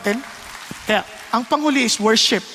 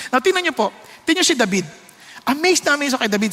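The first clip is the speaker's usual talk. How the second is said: ordinary talk, nothing marked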